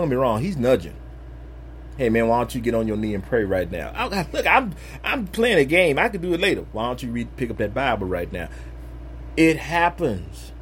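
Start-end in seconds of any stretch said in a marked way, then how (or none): 2.0-3.9 lower, quick, coaxing nudge; Spirit nudging voice. Retold or imitated. 6.7-8.5 softer, lower coaxing; soft nudging voice. Retold or imitated. Spirit nudging him.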